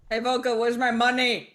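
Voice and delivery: loud call demanding payment; demanding shout. Acted. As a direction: shout it across at someone who owes you. Calling out to demand a debt.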